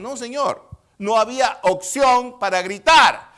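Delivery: loud, high, slowed rebuttal; full-force preaching. Real, not acted. Preacher rebutting a doubter before the congregation, loud and emphatic. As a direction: hammer the denial out slowly and loudly.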